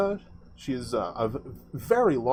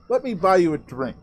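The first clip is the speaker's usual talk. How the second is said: raised, louder friendly offer; generous Rish offer; 6 semitones higher, 5 dB louder than usual. Acted. Rish steering Amet to the bar, hearty and raised. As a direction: sweep them to the bar with a grand offer. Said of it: "he goes".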